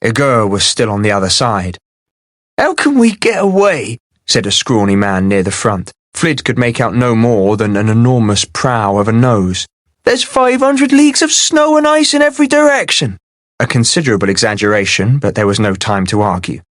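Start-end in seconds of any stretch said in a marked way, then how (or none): 2.6-4.0 high, thin anxious man; a nervous scrawny villager pleading. A narrator's reading. 10.0-13.2 high, thin anxious man; a nervous scrawny villager pleading. A narrator's reading.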